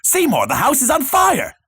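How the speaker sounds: high-pitched alarmed mother call; mother yelling. Acted. Mother calls to Seymour about the fire, high acted yell.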